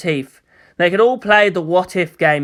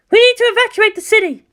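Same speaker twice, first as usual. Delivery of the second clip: high, loud and fast; urgent commander; 3 dB louder, faster than usual. Acted. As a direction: press the point hard. Commander urging evacuation.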